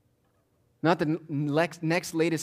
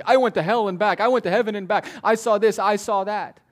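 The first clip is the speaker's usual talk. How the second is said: loud, high boasting of self-proclaimed witnesses; raised, insistent mock testimony; claiming authority; 6 semitones higher, 6 dB louder than usual. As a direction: imitate boastful testimony, punching each claim. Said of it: "People claiming authority".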